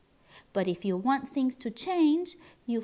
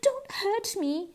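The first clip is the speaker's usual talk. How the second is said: whimper; 10 semitones higher, 4 dB louder than usual. Acted. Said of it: "he whimpered".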